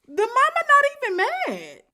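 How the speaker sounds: high, loud and slow exclamation; amazed exclaim. Real, not acted. Cohost marveling the mama stayed calm, loud and slow.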